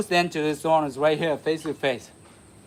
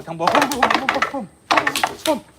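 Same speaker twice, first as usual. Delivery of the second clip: rising higher boom boom for each hit; combo punch sounds; 7 semitones higher than usual. Shown as a demonstration. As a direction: fire off quick high booms like a combo. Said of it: "You go, uh".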